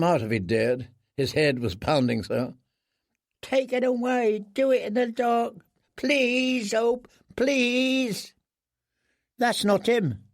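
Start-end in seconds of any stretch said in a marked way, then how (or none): 3.4-8.3 high, frightened begging to douse the light; a broken man shielding his eyes and begging; pleading prisoner. Acted. Prisoner begging the boys to take the light away, high pleading. 9.4-10.2 put-on boy's voice. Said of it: "said a boy's voice".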